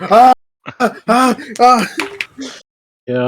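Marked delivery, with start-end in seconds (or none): 0.0-1.9 warning ah ah ah. Acted.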